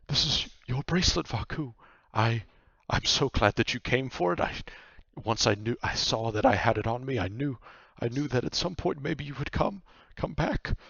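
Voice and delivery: eager, emotional nightmare; earnest nightmare. Acted. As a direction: confess your gladness, stumbling over restarts. Nightmare hands bracelet to Vaku.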